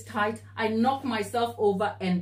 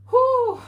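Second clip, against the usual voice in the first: loud whew; 8 dB louder than usual. Real, not acted. Overheated recalling the disaster.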